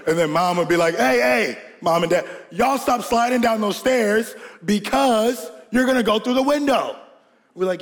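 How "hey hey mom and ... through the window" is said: higher-pitched imitation of a scolding mom; mom's stern warning. Mom telling boys to stop sliding down stairs, stern warning. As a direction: warn the kids in a raised-pitch mama voice.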